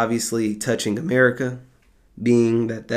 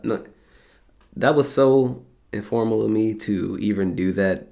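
usual speaking voice

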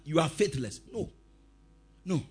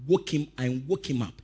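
ordinary talk

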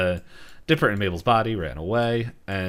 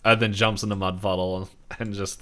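ordinary delivery

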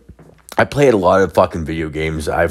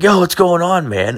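cheery greeting; 12 semitones higher than usual. Retold or imitated.

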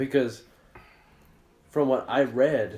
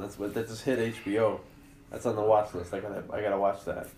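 normal talking voice

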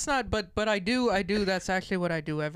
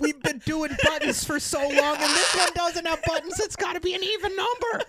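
high-pitched hammed-up executive; mock Apple exec; 10 semitones higher than usual. Co-host mocks Apple's naming in a high voice. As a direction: whine like a smug tech boss.

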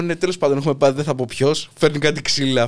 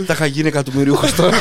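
laughing retold joke. Guest retelling his friend's joke after the accident, laughing. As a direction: quote it through laughter.

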